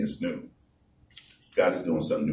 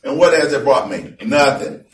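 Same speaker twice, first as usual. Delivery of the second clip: preaching at full force; 8 semitones higher, 9 dB louder than usual. Acted. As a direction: boom the verses out to the congregation. Preaching about Solomon's frustration.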